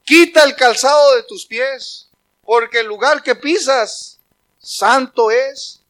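high, loud call turning slow and solemn; God commanding. Acted. God stops Moses on holy ground, loud slow command. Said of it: "entonces Dios le tuvo que hablar".

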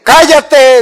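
sudden loud, high shouted order. Shown as a demonstration. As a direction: yell it to cut everyone off. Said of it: "le digo así, miren". (grito fuerte y agudo)

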